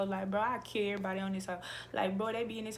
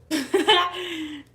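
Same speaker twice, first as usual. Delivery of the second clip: sudden loud, high burst of laughter; 8 semitones higher, 11 dB louder than usual. Real, not acted. Laughs between telling someone shut up.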